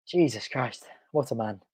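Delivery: ordinary talk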